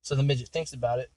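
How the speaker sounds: ordinary talk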